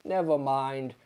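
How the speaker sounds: ordinary delivery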